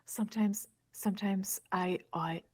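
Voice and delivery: put-on a British accent, dreamily brooding. Friend mocks a movie heartthrob in a British accent. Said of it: "Your accent is so good".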